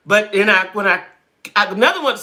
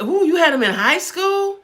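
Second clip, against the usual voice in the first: surprised; 11 semitones higher than usual. Real, not acted. Reacting to a viewer's comment.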